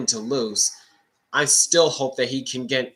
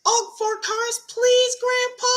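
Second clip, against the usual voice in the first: put-on very high, shouted kid-like voice; a boastful grandson, squeaked out; young Ty voice; 4 dB louder than usual. Ty begging grandpa.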